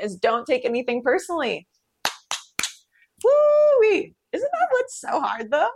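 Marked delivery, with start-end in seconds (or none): 3.2-5.7 high, loud hoot, then slow; exaggerated woo. Real, not acted. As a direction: whoop it up like it's too much. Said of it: "reacting to second agreement".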